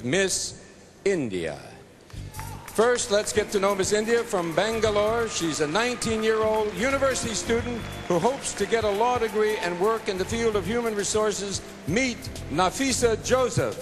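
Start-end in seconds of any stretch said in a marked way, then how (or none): 2.7-13.8 upbeat, higher host introduction; pageant announcer. Acted. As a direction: present the contestant with flair.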